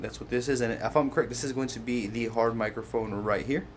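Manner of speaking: ordinary delivery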